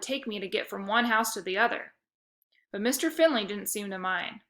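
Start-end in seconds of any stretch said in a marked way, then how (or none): none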